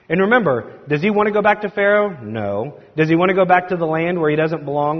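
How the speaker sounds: ordinary talk, nothing marked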